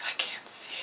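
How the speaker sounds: pitiful whispered complaint. Retold or imitated. She tells her boss why she wears sunglasses, whispered. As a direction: breathe it out helplessly.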